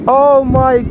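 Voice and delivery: very high excited yelps; sledding downhill. Real, not acted. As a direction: squeal while speeding downhill. Sliding down the hill.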